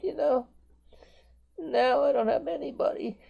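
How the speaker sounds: choked up, close to tears; tearful. Real, not acted. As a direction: voice pitched high and breaking with grief. Grieving widower talks of wife's death.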